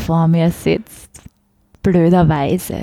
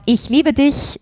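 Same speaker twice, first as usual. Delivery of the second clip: „Ich liebe dich“ tossed off higher in a playful Meidling Viennese lilt; joking Meidling love line; 8 semitones higher, slower than usual. Shown as a demonstration. To the host, showing the jokey Meidling version of a love line. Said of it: "sage ich".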